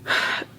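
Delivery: short breathy huff of annoyance; exasperated sigh. Retold or imitated. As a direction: sigh it out, fed up. Exasperated reaction, retold as pissed.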